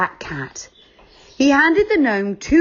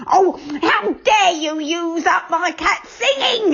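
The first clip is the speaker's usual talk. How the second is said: much higher, indignant shout; angry cry; 9 semitones higher than usual. Acted. Witch's assistant scolding the children, furious. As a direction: bark it at the grinning children. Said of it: "he cried".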